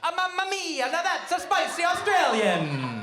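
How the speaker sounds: put-on high, drawn-out Italian caricature passed off as Australian accent; botched Australian accent. Comic's third try at the Australian woman, absurdly wrong Italian-style accent. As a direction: sing out a stage-Italian attempt at Australian. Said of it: "Third time's the charm".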